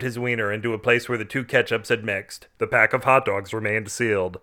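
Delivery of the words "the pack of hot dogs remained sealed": ordinary delivery, nothing marked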